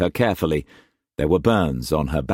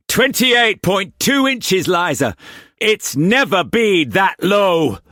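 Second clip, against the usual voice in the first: high, slow alarmed voice; alarmed old sailor; 12 semitones higher, 4 dB louder, slower than usual. A narrator's reading. Brock reading the barometer to Liza, alarmed. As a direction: sound the alarm.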